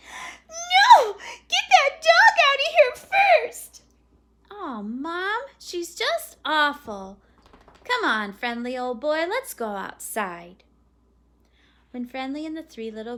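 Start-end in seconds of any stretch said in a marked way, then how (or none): 0.1-3.8 put-on very high, loud childish wail; frantic wailing refusal. A narrator's reading. Girl refusing to leave the chair, frantic wail. 4.5-10.6 lightly voiced fed-up boy; a hint of a kid grumbling, drawn out; disgusted boy. A narrator's reading. Boy complaining to mom about his sister, mildly disgusted.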